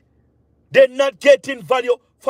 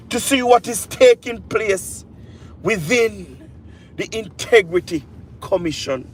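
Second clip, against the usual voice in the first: ordinary talk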